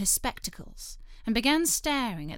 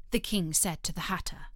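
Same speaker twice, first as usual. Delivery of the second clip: plain reading voice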